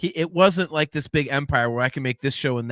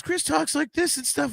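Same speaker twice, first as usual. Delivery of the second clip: much higher mock voice of the co-host; co-host's voice; 14 semitones higher than usual. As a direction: tease the co-host with a high-pitched imitation. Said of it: "we had joked about your voice".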